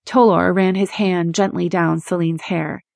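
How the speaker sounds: usual speaking voice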